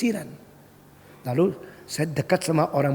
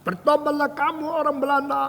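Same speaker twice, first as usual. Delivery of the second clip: high, drawn-level preacher's cry; preaching call; 11 semitones higher than usual. Retold or imitated. Indonesian preacher to Dutch crowd, high preaching call. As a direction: imitate a street preacher calling out on a high pitch. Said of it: "seorang Indonesia lagi berkhotbah".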